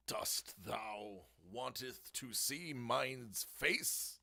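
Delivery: slow, drawn-out olde English; slow mock-courtly question; mock archaic speech. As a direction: address a lord with hammed-up ceremony. Mock archaic address to Lord Brownie.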